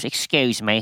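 imitated British hotel guest; British accent. Valet retells a rich guest stopping him, British accent. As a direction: put on a polite British "excuse me". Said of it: "He's this British guy".

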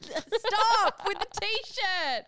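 slow, drawn-out whiny stop; playful protest. Real, not acted. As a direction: drag the words out in mock exasperation. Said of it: "teasing protest".

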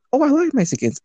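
high, airy imitation of an awkward girl; mock ditzy girl. Imitating the girl at the library, high mock ditzy voice. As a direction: squeak it like a clueless girl.

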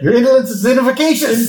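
high, quick pompous villain; Mister Burns. Retold or imitated. Co-host takes over the boss's insult, high pompous imitation. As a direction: deliver it as a hammed-up haughty boss. Second try at Burns's line.

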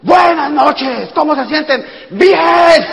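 high, loud, drawn-out crowd reply; hammed-up group shout. Five friends answering the presenter before a guest, hammed-up shouting. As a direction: imitate an overeager little crowd yelling back. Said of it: "Y nosotros allí".

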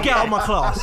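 loud, high imitation of a teacher; angry teacher. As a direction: act out a teacher's outburst through laughter. Retells teacher throwing him out.

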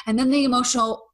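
normal talking voice

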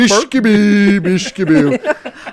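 high, drawn-out singsong gibberish; silly puppet cook babble, laughing by the end; Swedish Chef. Acted. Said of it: "throw the Swedish Chef in this".

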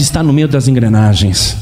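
usual speaking voice

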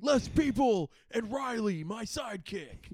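slow, hammed-up announcement; film hero voice. Hero introducing his sidekick, lightly hammed-up. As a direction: present the sidekick with slow grandeur.

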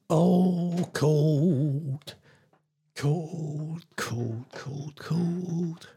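drawn-out musing, fading to a whisper; teasing musing. Pretend. Teasing out the clue word.

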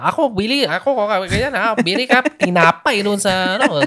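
high-pitched mock mainland Chinese accent in broken Tagalog. Son imitates his mainland Chinese mom's accent. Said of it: "siya yung typical na makikita mo na".

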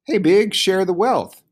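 high, loud animal snap; sharp, high-pitched demand; snappish. A narrator's reading. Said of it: "Harry Spider snapped".

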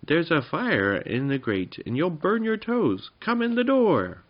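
high, urgent warning rising in pitch; frightened call. A narrator's reading. Jinx warning the wizard about the fire, frightened call. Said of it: "called Jinx in fright".

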